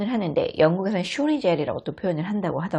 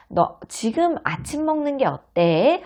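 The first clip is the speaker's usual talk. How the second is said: higher, slow gentle motherly question; gentle mom suggestion; 9 semitones higher, slower than usual. Acted. Mom suggests breakfast to child, slow gentle and higher. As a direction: coax the child kindly. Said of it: "엄마가 물어볼 거예요".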